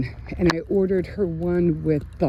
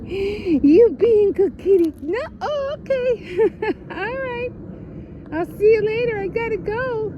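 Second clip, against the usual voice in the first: much higher, cooing pet voice; 4 dB louder than usual. Real, not acted. Greeting the cat she found, high cooing baby talk.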